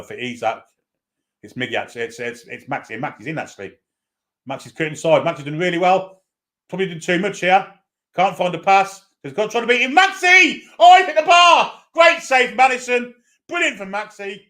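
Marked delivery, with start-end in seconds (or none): 4.5-10.0 rising excitement. Real, not acted. Newcastle attack builds to shot. 10.0-11.8 excited shout. Real, not acted. Shot hits the bar. 11.9-14.4 still excited. Real, not acted. After the near miss.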